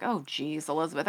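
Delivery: low, soft aside to yourself; retold inner groan at yourself, quiet and low; muted self-reproach. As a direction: lightly chide yourself under your breath. Retold chiding of herself in thought.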